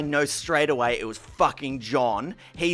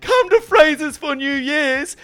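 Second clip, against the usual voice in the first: mock sing-song invite; 12 semitones higher, 8 dB louder than usual. Mock invite from hated people.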